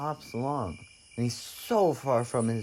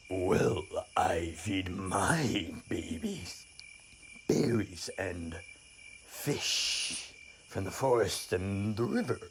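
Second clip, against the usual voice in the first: low, slow bear voice; lumbering mother bear; 4 semitones lower, much slower than usual. Acted. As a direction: draw the words out deep and heavy.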